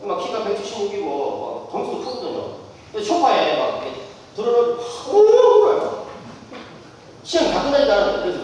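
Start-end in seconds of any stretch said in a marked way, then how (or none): none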